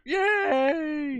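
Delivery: high, bright-eyed mock cheer; excited cheer. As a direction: cheer it like a kid. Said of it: "I was hoping for you guys to go".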